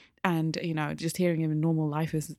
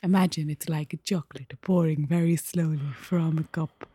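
soft husky voice. Pretend. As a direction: voice pouring like melted chocolate. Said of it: "this is how I'm gonna talk".

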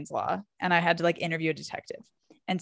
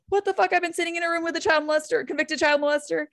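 higher, stunned retelling of her own shock; shocked disbelief; 10 semitones higher than usual. Retold or imitated. Student reporter to herself, mock horrified disbelief. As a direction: replay the moment you realised who sat beside you.

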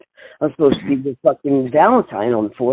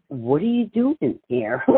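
slow, higher, laughing through the words; amused greeting through a laugh; laughing question; 8 semitones higher, slower than usual. Retold or imitated. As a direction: ask it while breaking up laughing. [name] greets her, laughing.

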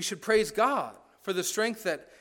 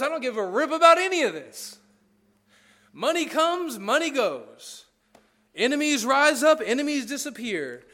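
loud, high defiant declaration; Paul's defiant boast; 7 semitones higher, 6 dB louder than usual. Retold or imitated. Paul shrugging off his circumstances, loud and defiant. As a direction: proclaim it like nothing can touch you. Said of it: "and he goes".

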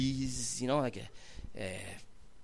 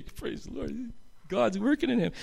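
higher, softer voice of a grateful mother; mom's voice; 9 semitones higher, 3 dB quieter than usual. Retold or imitated. Mother thanking God for her son's change, lightly voiced higher mom. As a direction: lift the voice like a relieved mother. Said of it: "My mom would just look at me and say".

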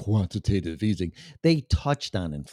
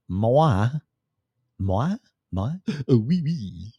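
mock French; slower than usual. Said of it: "Not French".

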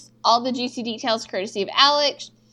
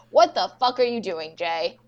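ordinary talk

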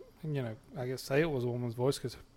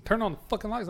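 mock yell; 8 semitones higher, faster than usual. Yelling at Alexa.

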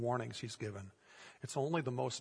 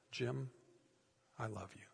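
soft, hushed, tender words; tender embrace; 9 dB quieter than usual. Retold or imitated. Billy Graham hugging the prisoner, softly tender. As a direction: say it like a long-awaited hug.

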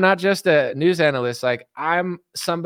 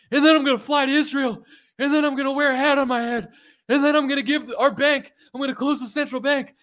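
mock Milei speech; 8 semitones higher, faster than usual. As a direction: parody a frantic politician listing promises. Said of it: "he's like".

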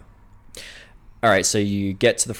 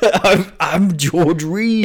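put-on slow, higher Scottish accent through a laugh; George Reed Scottish voice; 6 semitones higher, 3 dB louder, much slower than usual. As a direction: announce yourself in a hammy Scottish accent. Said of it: "Who are you?".

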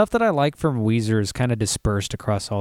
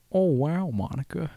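laid-back, pitched-up sigh of a name; imitating Owen Wilson; Owen Wilson as Clinton's friend; 3 semitones higher, 5 dB quieter than usual. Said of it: "Owen Wilson and Bill Clinton".

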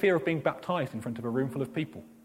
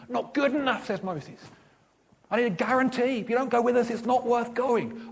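insistent Moses; 7 semitones higher than usual. Acted. Moses pleading with God.

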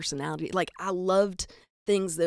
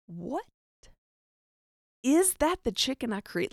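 mock disbelief, hushed what rising into a higher question; lightly voiced puzzled creator; Jesus; 5 semitones higher than usual. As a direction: act baffled by your own creation. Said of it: "Jesus is looking down like".

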